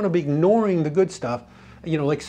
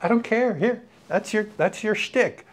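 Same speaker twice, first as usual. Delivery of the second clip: easygoing dismissal; 6 semitones higher than usual. Retold or imitated. Retold letting a habit go.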